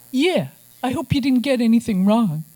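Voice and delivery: a hint of a cocky Brooklyn producer; Brooklyn accent. Actor-producer needling the author on the phone, light Brooklyn accent. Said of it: "where he got this Brooklyn accent".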